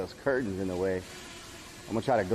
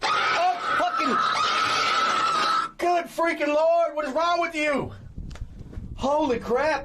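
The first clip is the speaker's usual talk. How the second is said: high startled cursing; slower than usual. Real, not acted. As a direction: curse in high-pitched fright. Sudden loud noise scares him.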